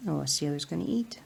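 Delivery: soft unvoiced whisper. Real, not acted. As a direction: whisper it so as not to disturb. Whispering over the pet.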